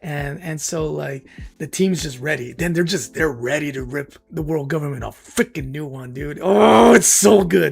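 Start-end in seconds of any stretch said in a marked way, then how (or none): 6.4-7.7 loud, thrilled outburst; excited gush. Real, not acted. Vlogger gushing to viewers about his favorite arc, loud and thrilled. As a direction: burst out with delight at the memory.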